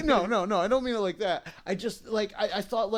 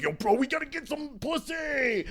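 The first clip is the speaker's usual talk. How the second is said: higher, slower dude-bro drawl; 7 semitones higher than usual. Retold or imitated. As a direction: sell it like a horny teenage bro. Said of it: "all my friends around me are like".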